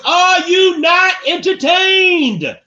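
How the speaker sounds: very high, very slow roaring shout; full gladiator roar to the crowd. Shown as a demonstration. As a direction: bellow it to the whole arena. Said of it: "In my gladiator voice".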